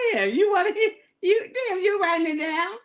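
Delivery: high, breathless laughing talk; squeaking out words through giggles. Real, not acted. Host teasing Tim, still laughing, voice pitched high.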